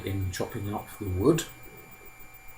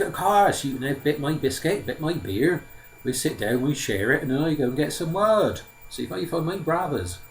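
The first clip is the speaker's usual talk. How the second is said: higher-pitched simple lad in a put-on accent; naive Simpleton, eagerly obliging; 5 semitones higher, 3 dB louder than usual. Simpleton offers the old man a share, naive and obliging. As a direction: play an artless youngest son, pitched up.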